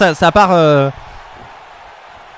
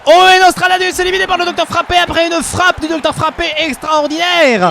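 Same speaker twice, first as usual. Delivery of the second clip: much higher announcer voice; play-by-play call; 8 semitones higher than usual. Acted. Commentator calling the knockout to viewers: excited announcer.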